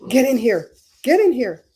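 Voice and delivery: loud summoning call, punched on get; calling out get in here. Retold or imitated. Streamer calling viewers in with a loud summons. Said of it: "I'm gonna be like".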